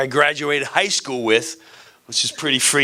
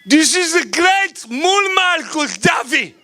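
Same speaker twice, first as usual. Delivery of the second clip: over-the-top Gaddafi. Pretend. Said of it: "I'm this over the top".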